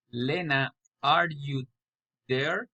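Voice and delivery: "there" rises as a question; rising intonation. Shown as a demonstration. Learner practising direct address, rising on "there". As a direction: lift "there" at the end. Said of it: "raising intonation".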